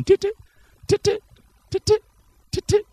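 high ticking heartbeat imitation; heartbeat sound. Preacher imitating a heartbeat, high ticking. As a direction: tick like a heart beating.

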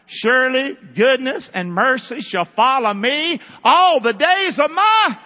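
high, loud ringing proclamation; preacher belting out the psalm; proclaimed psalm. Real, not acted. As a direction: declare the verse like a trumpet.

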